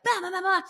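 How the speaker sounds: high babbled "ba ba ba"; imitating own hysterical gibberish; mock frantic babble. Daughter going crazy on the phone, frantic babbling.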